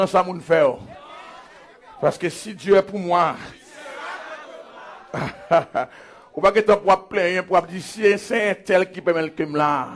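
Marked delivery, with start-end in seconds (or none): 5.1-5.8 brief soft laugh; low chuckle. Real, not acted. Preacher amused mid-advice to church, low chuckle. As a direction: chuckle lightly between phrases.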